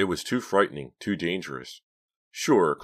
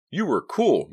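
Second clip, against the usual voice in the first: high, loud, excited praise; congratulating; 13 semitones higher, 9 dB louder, slower than usual. A narrator's reading. Relieved back-seat partner praising the driver, excited congratulation. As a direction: cheer her on in a high voice. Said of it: "Mickey congratulated her".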